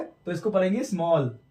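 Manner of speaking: normal talking voice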